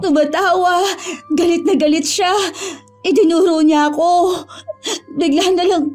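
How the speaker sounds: frightened account with catches of breath; shaken, tearful. Acted. Woman recounts her nightmare to her boyfriend, shaken and tearful. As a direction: recount it through sniffles, in broken phrases.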